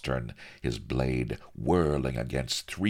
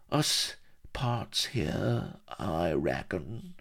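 put-on very slow, softer dying old man; feeble farewell; 4 semitones higher, 3 dB quieter, much slower than usual. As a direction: force out a brave last goodbye. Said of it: "he forced a feeble grin".

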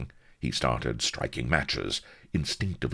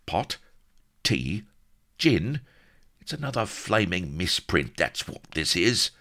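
higher-pitched, halting puzzled questions; fevered muttering; 6 semitones higher than usual. A narrator's reading. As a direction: puzzle aloud in a high voice, then complain. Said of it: "fevered mutters of".

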